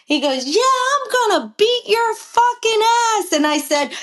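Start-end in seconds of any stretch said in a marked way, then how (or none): 0.5-3.3 high-pitched imitation of a rival's threat; retold angry threat. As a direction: act out his cursing, voice raised in pitch. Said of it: "he's cursing me out".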